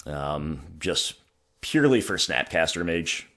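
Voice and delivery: usual speaking voice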